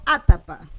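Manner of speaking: atapa with a loud opening "a"; stress on first syllable. Shown as a demonstration. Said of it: "yo acentúo la primera".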